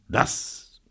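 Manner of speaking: choked, soft start; coughing fit. A narrator's reading. Sick man answers Robert's plan, coughing. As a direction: force the word out through a cough. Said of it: "von heftigem Husten geschüttelt".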